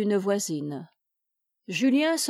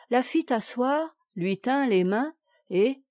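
ordinary delivery, nothing marked

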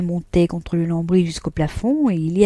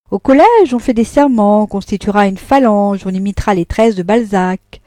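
resentful tirade; 2 semitones higher, 6 dB louder than usual. Acted.

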